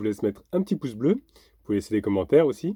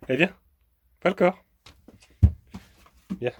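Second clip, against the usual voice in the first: higher, louder coaxing; talking to a dog; 8 semitones higher, 5 dB louder than usual. Real, not acted. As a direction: call the dog sharply but sweetly. Calling the dog. (appeler le chien plus fort)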